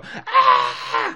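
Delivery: little ponies shrieking as they flee; fillies' scream. Retold or imitated. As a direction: squeal like frightened fillies. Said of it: "all the Fillies go".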